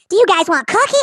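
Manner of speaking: very high, loud mock-mom chirp; imitating mom. Mom barging in on teenage son, imitated in a high voice. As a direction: pitch way up, loud, as an oblivious mom.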